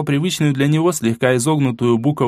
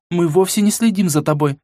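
put-on girl voice; 7 semitones higher than usual. A narrator's reading. Mila voiced higher.

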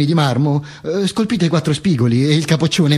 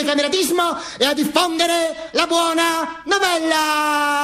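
high, chanted proclamation, last word stretched out; fascist rally orator; 11 semitones higher than usual. Acted. Follower proclaiming comradeship to the world, high monotone oratory. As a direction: declaim on one high note, drawing out the ending.

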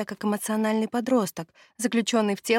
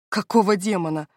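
growled curse, a touch louder; angry growl; 3 dB louder than usual. A narrator's reading. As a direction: snarl it out in anger. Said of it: "Зло зарычал он". (злобно рычит)

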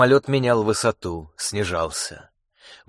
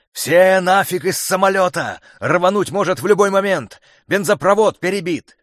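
urgent shout; 7 semitones higher, 4 dB louder than usual. A narrator's reading. Pilot orders evacuation.

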